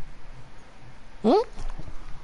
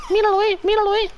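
habla de bebé; faster than usual. Real, not acted. High baby talk. (vocecita aguda para el bebé)

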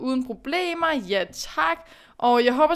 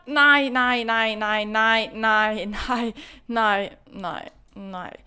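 dismayed repeated no; 3 semitones lower, much slower than usual. Real, not acted. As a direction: groan in disbelief, trailing off. Lost near finish line.

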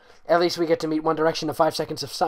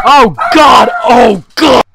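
much higher, very loud yell; startled shout; 10 semitones higher, 22 dB louder, slower than usual. Real, not acted. Reader jolted by a sudden noise, real shout.

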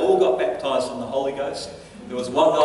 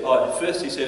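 usual speaking voice

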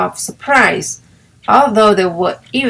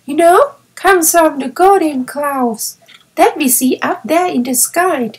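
eager young girl playing in the snow; child delighted with her new playmate; little girl Violet; 7 semitones higher than usual. A narrator's reading. Violet speaks in story.

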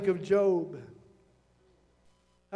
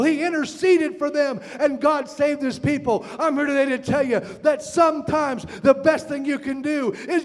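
higher, driving pulpit delivery; impassioned preaching; 5 semitones higher, 3 dB louder than usual. Real, not acted. Preacher telling church of Moses interceding, impassioned preaching. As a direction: preach it hard, phrase after phrase.

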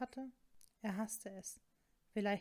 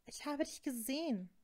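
blurted accusation, pitch raised; higher-pitched, sudden confession; 5 semitones higher than usual. A narrator's reading. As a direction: let it slip out as an accusation. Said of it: "damit herauszuplatzen".

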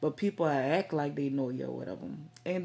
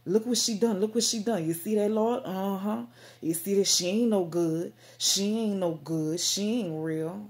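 loud, higher mock-scandalized tattling; judgmental Pharisee; 4 semitones higher, 6 dB louder than usual. A Pharisee telling the Lord on her, loud mock outrage. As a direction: play a tut-tutting holier-than-thou accuser. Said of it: "A Pharisee or seduce it".

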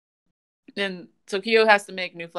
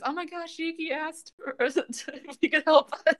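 high, hushed fangirl gushing; mock flipping out; 9 semitones higher than usual. Classmates thrilled that Yuki asked for help, flipping out. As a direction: imitate starstruck classmates, pitched way up, breaking into laughter. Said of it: "they're all, like, flipping out".

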